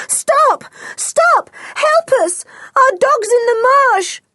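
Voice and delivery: high, frantic shout for help; urgent yell. A narrator's reading. George yells to a passing lorry, urgent yell. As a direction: yell to flag someone down. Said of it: "George yelled to it".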